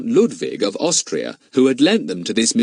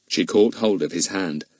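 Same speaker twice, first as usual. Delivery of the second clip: usual speaking voice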